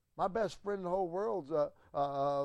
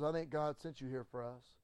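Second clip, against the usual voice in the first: tearful plea; 3 semitones lower, 8 dB quieter than usual. Retold or imitated. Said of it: "with a tear in his eye".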